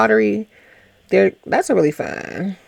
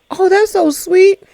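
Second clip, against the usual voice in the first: high gushing imitation of Tay; 11 semitones higher than usual. Retelling Tay's gushing reply.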